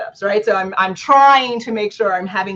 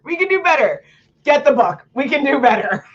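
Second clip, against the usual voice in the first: emphatic encouragement; 6 semitones higher than usual. Real, not acted. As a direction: lift it up with energy. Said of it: "repeated higher".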